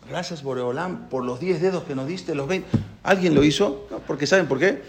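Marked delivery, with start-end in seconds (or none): 0.1-2.7 soft prayer. Pretend. Quiet prayer of thanks.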